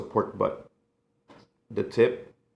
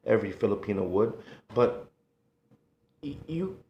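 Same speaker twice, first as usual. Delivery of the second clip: normal talking voice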